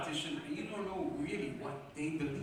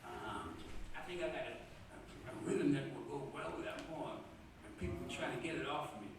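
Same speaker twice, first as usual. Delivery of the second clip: retold confidential aside; 5 semitones lower, 10 dB quieter than usual. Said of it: "Sasa Lee pulled me aside and said".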